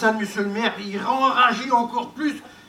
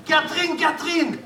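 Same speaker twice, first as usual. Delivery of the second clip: high call across the yard; 6 semitones higher than usual. Acted. Repeating the lover's call to the window, high. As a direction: call her name up to the window.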